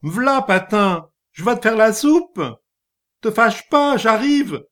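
high, loud, eager call; crying out. Acted. Wife shouting down through the floor to calm him, loud appeasing cry. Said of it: "Elle cria à travers le plafond".

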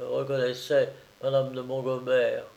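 usual speaking voice

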